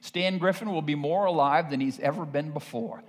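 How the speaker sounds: normal talking voice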